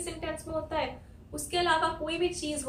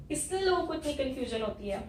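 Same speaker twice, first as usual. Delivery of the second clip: ordinary talk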